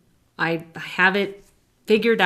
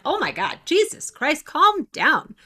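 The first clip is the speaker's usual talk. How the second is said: exasperated; 8 semitones higher than usual. Retold or imitated. Telling her inner voice off.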